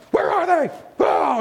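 put-on high, loud panicked cry for a lost child; a mother who suddenly can't see her kid; panicked mom. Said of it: "total panic".

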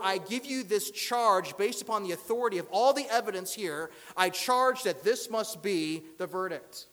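loud, forceful prosecutor's declaration; courtroom charge. Acted. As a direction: pronounce the verdict to the court. Acted lawyer giving charge and verdict.

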